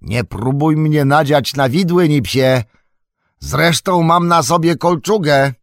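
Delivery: high, loud call on the run; shouted warning. A narrator's reading. Sam warns Nips off with the pitchfork, shouting. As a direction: shout ahead to hold off an attacker. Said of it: "zawołał w pędzie jeszcze Sam".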